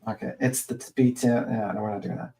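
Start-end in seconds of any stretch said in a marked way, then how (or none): none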